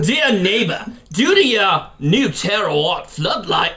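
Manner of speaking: put-on slow, laboured Australian accent; badly faked Australian, drawn out. Host reads a neighbor's letter, clumsy Australian. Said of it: "try to do my Australian voice".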